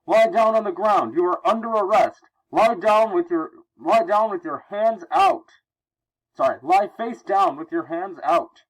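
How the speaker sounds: high, loud officer barking orders; shouted police command. Acted. Voicing police making an arrest.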